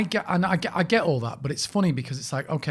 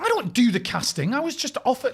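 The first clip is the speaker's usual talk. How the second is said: high-pitched mock protest; indignant protest; 10 semitones higher, 3 dB louder than usual. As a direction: defend yourself in raised, exasperated pitch. Imagined protest to the crew member.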